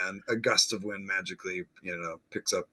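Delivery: ordinary talk, nothing marked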